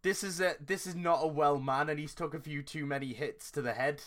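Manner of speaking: normal talking voice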